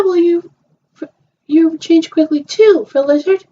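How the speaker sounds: high, bright-eyed play character; a doll chatting after check-in; girly doll voice. Pretend. Voicing toy girls at hotel.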